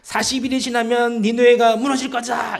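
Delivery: loud, high monotone proclamation; mock proclamation. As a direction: call it out like a street prophet, acted. 요나의 니느웨 선포를 재연. (크게 외치는 선포 흉내)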